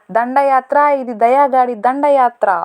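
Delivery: filmy punch dialogue. Pretend. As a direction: belt it out like a hero's dare. Movie punch dialogue quoted. (Cinema hero punch dialogue లా గట్టిగా)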